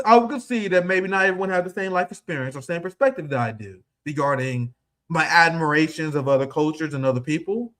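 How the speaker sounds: normal talking voice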